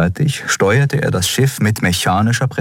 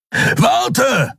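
calling out. Acted. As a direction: call him back urgently. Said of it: "rief Kero".

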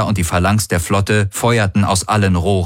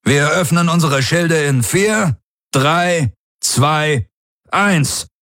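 high, raised countdown call; commanding countdown; 8 semitones higher, slower than usual. A narrator's reading. Commander counts down to opening the shields, commanding. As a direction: count them down to launch, pitch up.

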